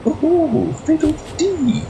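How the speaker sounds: high, gleeful cheer; excited whoop. Real, not acted. Excited cheer while gaming.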